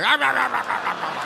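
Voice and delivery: rapid "rah rah rah" chomping, starting high; mock gobble that tails off; eager gobbling noises. Man taking leftovers home, frantic mock gobbling.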